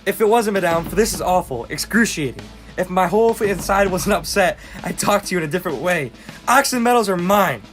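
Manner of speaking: high, loud outraged tirade; angry shouting. Acted. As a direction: shout in mounting exasperation at the neighbor. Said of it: "What are you shouting at?".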